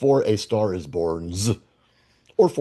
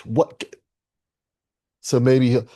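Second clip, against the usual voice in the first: ordinary talk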